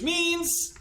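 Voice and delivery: high, sung-out lead-in; host cueing the jingle a third through, high announcer call; show-host cue. Real, not acted. Lift it high and hang there.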